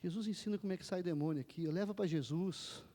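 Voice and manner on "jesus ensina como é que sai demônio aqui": ordinary talk, nothing marked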